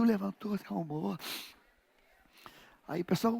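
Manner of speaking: ordinary talk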